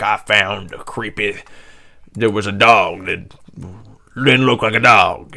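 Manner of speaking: put-on slow, uncertain rustic American accent; attempted Maine accent. Host imitating Americans reporting a creepy dog, botched Maine accent. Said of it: "I don't know what accent I'm trying".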